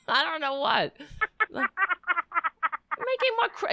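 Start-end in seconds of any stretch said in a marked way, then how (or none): 1.5-3.7 high, slow mimicking English accent; mock English accent. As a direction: imitate a flustered English accent in falsetto. Mimicking the other's panicky impression.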